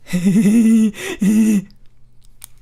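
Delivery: squeaky high-pitched laugh; a shrill, cringy fake giggle. Shown as a demonstration. Cringy laugh demo after "Like this.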